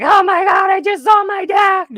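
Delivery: high, loud shocked exclamation; sudden high shout of shock; shocked cry. Retold or imitated. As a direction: cry out in disbelief. Retelling shock of seeing dad.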